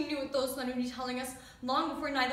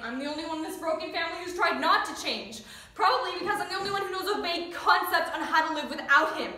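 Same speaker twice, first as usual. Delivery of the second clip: loud, raised-pitch grievance; frustrated outburst; 5 semitones higher, 11 dB louder than usual. Acted. Only one in a broken family trying not to change, frustrated outburst. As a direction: burst out with it, loud and high.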